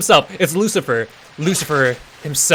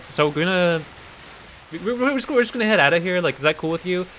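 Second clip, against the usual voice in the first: higher-pitched, halting request; awkwardly tentative; 4 semitones higher, faster than usual. Acted. Jeff asking Satan to let them leave, awkwardly tentative. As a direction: edge toward the exit, asking permission.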